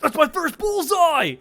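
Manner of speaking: high, loud, drawn-out shout of victory; triumphant yell. Acted. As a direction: yell it out like a winning shot. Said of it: "he, like, yells out".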